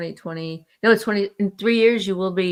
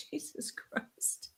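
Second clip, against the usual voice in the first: very soft, slow whisper; whispered exclamation; 19 dB quieter, much slower than usual. Real, not acted. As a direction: breathe it out under your breath. Muttered aside after a laugh.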